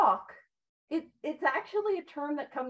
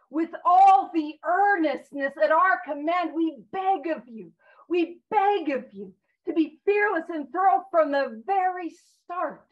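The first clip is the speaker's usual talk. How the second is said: louder, slow, earnest pleading; earnest plea; 6 dB louder, slower than usual. Shown as a demonstration. Sponsor reading the book to the group, earnest and pleading. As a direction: beg them with all you've got. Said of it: "read it with the inflection that I feel like it was meant to be read".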